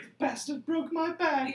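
high, slow woman's voice; man doing a woman's voice. Retold or imitated. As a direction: play a man faking a lady voice. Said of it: "gets his woman voice back".

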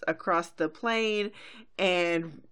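ordinary talk, nothing marked

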